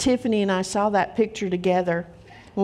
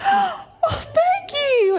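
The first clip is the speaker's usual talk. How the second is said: high, slow, tearful gasp of thanks; overwhelmed, voice breaking with tears; choked-up thanks; much slower than usual. Retold or imitated. Emotional hug at donut shop.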